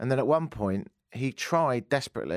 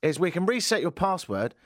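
higher, patient explaining voice; helpline woman; 6 semitones higher than usual. Retold or imitated. Helpline woman explaining the password reset, higher put-on voice. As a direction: imitate a procedural phone helper. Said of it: "She goes".

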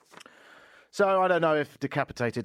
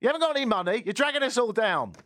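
mock outraged rant; 13 semitones higher, 5 dB louder than usual.

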